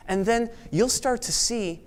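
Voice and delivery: usual speaking voice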